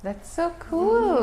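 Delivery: high, slow, delighted; excited. Real, not acted. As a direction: gush with delight. Host reacts to story.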